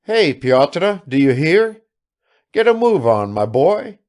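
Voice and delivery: high, loud hailing; calling the servant. A narrator's reading. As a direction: shout orders to a servant across the yard. Master calling to his servant.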